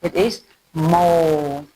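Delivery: normal talking voice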